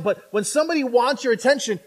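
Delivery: ordinary talk, nothing marked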